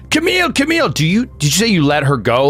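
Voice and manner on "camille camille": high yelled name; father yelling for his wife. Acted. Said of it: "he starts yelling".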